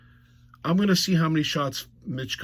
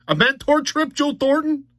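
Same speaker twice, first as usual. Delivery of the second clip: high-pitched disbelief; incredulous; 11 semitones higher, 3 dB louder than usual. Real, not acted. Fan mocking the Joe Thornton mentor trip, incredulous. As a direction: repeat it in rising, scoffing amazement.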